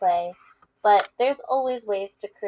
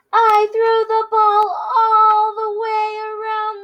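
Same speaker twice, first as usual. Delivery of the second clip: loud, much higher bragging; proud boast; 14 semitones higher, 9 dB louder, slower than usual. Acted. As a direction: crow about it to your friend. Pig brags about his throw.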